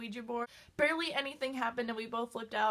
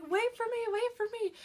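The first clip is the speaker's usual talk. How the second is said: high, loud calling after her; yelling for help; 10 semitones higher, 4 dB louder than usual. Retold or imitated. Friend left in the basement calling after her, high and loud. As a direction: call up the stairs, left behind. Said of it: "yelling for me".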